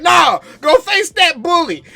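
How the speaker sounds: loud, high parent's order; parent yelling. Retold or imitated. Parent ordering the kid to fight the bully, loud yell. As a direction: bark it like a parent sending a kid back out. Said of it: "it was like".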